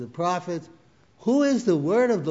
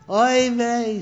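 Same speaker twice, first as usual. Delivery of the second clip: loud, high dismayed cry; dismayed exclamation; 7 semitones higher, 6 dB louder than usual. Retold or imitated. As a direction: throw up your hands in dismay. Shocked Orthodox father in joke.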